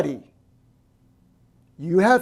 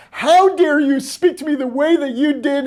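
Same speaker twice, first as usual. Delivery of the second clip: higher, louder mock outrage at a friend; mock indignant outburst; 7 semitones higher, 5 dB louder than usual. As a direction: act wronged and indignant, hamming it up. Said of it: "And it's like".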